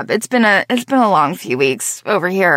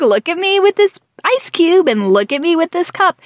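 put-on high, bright little-kid voice; voicing her toddler; 9 semitones higher than usual. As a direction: play the show-off toddler. Mother voices her toddler daughter.

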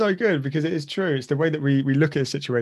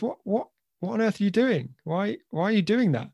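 much higher, stammering disbelief; shocked dad; 8 semitones higher than usual. Acted. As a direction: gasp it out like a stunned father. Dad shocked at manure-throwing son.

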